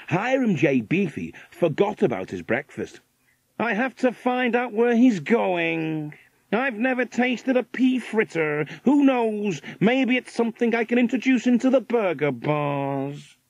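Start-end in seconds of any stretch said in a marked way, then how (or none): 3.6-13.4 American millionaire, eager. A narrator's reading. Burger millionaire set on following the bird, eager American voice. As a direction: play the keen American businessman, voice pitched up.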